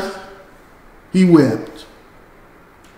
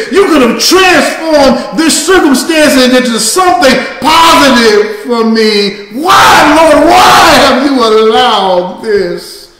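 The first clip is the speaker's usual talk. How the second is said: crying out to God; 3 semitones higher than usual. Acted. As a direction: shout the question up to heaven. Preacher voicing our cry to God.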